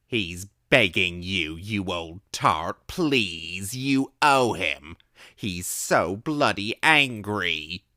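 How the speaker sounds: slow, loud, pleading Cockney rant; drunken shouting. A narrator's reading. Drunk vagrant shouting and pleading at her locked door. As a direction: beg and curse at the door. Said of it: "he was shouting".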